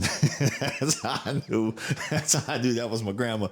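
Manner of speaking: laughing through the words, pitch up; can barely get it out for laughing. Real, not acted. Telling about grandma and the tortilla, laughing.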